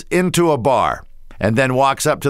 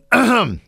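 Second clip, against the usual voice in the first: throat clearing; 9 semitones higher than usual. Real, not acted. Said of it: "clearing throat".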